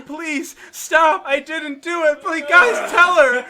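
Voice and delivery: high, loud desperate pleading; almost in tears. Retold or imitated. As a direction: imitate a panicked kid begging tearfully. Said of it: "now I'm almost in tears".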